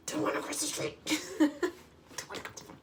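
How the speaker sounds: high-pitched, put-on reluctant whine; mock whine. Arguing with her own gut about crossing, mock whining. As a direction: whine like a balky kid, breaking into a laugh.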